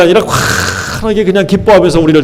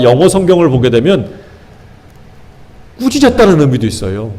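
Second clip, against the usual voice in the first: normal talking voice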